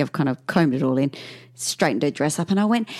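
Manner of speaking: ordinary talk, nothing marked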